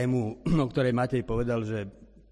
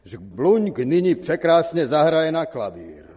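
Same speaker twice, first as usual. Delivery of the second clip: loud, high show announcement; booming ringmaster's introduction; announcer voice; 5 semitones higher, 9 dB louder than usual. A narrator's reading. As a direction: proclaim the next act to a big theatre. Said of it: "oznámil neznámý hlas".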